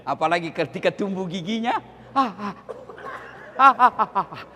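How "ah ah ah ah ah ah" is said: mock pained cries. Mother bitten while nursing.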